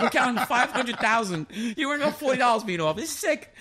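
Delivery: high-pitched laughing exasperation; incredulous complaint. Retold or imitated. Man replaying his complaint about counting cash by hand, incredulous. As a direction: protest at the absurdity, pitch way up. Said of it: "I'm like".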